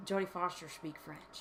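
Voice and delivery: lightly put-on slushy S sounds; Jodie Foster. Fan wanting to hear the actress's French, lightly imitated slushy voice. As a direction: slur the S towards SH in light imitation. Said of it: "my worst French Jodie Foster".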